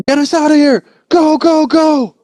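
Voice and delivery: high, loud frantic shouting; panicked yell. Retold or imitated. Scout yelling at driver to flee, frantic. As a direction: scream at the driver to go.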